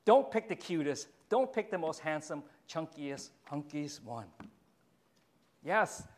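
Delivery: ordinary delivery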